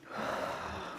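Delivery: long audible exhale sigh; exaggerated out-breath. Shown as a demonstration. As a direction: demo sigh. Breathing exercise with kids.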